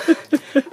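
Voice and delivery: high breathless laugh from the cold; shocked gasping laugh. Real, not acted. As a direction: laugh through the icy shock. Just drenched in ice water.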